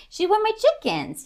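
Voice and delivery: high, playful sing-song repeat. Shown as a demonstration. Owner lilting a pet name toward her dog, sing-song. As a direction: lilt it way up like a cute refrain.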